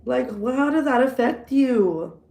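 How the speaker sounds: a loud indignant clapback for Tammy; mock angry comeback. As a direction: snap back at the nosy sister. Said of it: "you should have been like".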